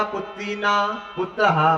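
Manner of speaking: slow "पुत्री ना", pause, then "पुत्रः"; re-phrased riddle. Acted. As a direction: stretch "ना" onto "पुत्री", then break. Astrologer re-parses his riddle. ("पुत्री ना" खींचकर, फिर "पुत्रः")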